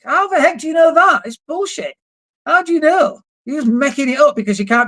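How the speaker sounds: high, loud exasperated outburst. Real, not acted. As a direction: challenge them, fed up. Angry rebuttal of excuse.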